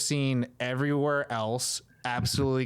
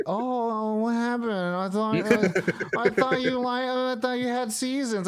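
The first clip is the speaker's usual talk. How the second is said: higher, teasing mock bewilderment; mock surprise; 7 semitones higher than usual. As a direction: innocently needle them, pretending shock. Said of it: "I'm just like".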